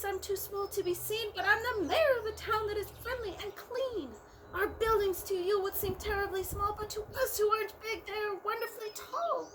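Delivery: mayor of the speck, grateful; tiny Who voice. A narrator's reading. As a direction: speak as a tiny grateful person. Mayor of Whoville speaking.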